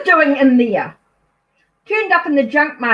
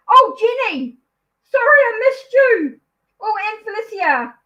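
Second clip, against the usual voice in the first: excited greeting; 10 semitones higher than usual. Real, not acted. Greeting chat viewers she overlooked.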